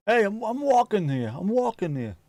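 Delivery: high, indignant New York street cry; hammed-up Brooklyn accent, pitched well up. Streamer playing an affronted New York pedestrian, loud-mouthed Brooklyn accent. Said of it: "get away with it in Brooklyn".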